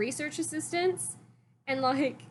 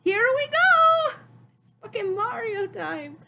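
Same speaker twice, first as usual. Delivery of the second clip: much higher, louder, slow cartoon plumber voice; Mario; 11 semitones higher, 7 dB louder, slower than usual. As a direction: belt it out like a cheerful video game plumber, slow and high. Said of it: "that sounded like Mar".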